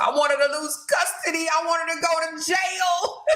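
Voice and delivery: high mock wail of a crying hater. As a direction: whine it like the crybabies.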